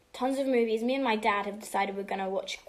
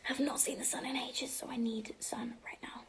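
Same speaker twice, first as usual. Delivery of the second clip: whispered; 8 dB quieter than usual. Real, not acted. Whispered aside.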